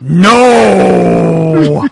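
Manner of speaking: crying shout of no; anguished cry. Acted. Woman crying out as he breaks away. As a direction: wail it out. Stefan cries out.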